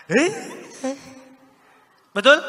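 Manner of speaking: put-on high, rising coo to a toddler; baby talk. Shown as a demonstration. Trainer showing how we greet a two-year-old, high playful baby talk. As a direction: coo upward as if greeting a tiny child. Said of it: "ketemu anak kecil usia dua tahun".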